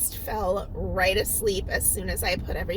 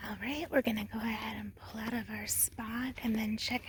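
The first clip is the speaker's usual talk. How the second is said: whispering; 9 dB quieter, faster than usual. Real, not acted.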